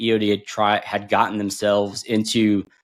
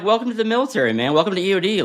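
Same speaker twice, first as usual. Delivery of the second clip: mock welcome; 10 semitones higher, faster than usual. Mock welcome speech to Marine.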